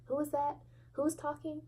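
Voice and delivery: exasperated, can't tell who's who; mock confusion. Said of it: "And I'm like".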